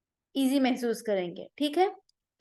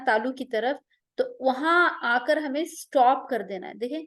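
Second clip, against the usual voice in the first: ordinary delivery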